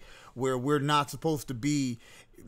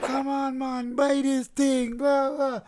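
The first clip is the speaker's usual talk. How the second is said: high, slow pushy vendor voice; mimicking street sellers; 12 semitones higher, 5 dB louder, slower than usual. Recalling roadside sellers pushing goods, high mock vendor voice. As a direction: hawker pleading in a high voice.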